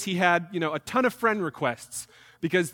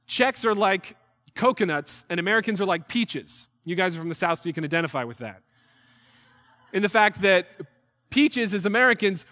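normal talking voice

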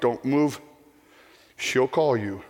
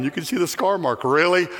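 usual speaking voice

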